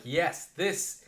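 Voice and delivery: thrilled rookie gushing over his dream job; excited. Retold or imitated. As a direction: gush like a kid who found his dream job. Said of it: "I was so excited".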